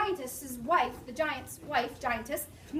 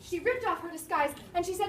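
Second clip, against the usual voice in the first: usual speaking voice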